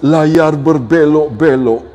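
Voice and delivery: slow, firm verse delivery; measured, declaimed wise words; firm recitation. Shown as a demonstration. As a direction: recite the pantun slowly and emphatically. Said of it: "Kadang-kadang cukup tegas".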